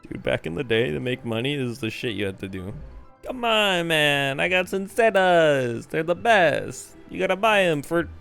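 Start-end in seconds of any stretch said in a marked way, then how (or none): none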